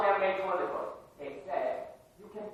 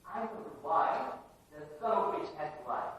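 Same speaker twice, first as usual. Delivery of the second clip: normal talking voice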